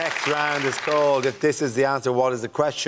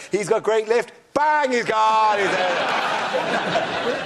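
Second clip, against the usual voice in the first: sports commentator; 9 semitones higher, much slower than usual. Retold or imitated. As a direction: call the jump like a hyped TV commentator. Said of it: "you'd be like".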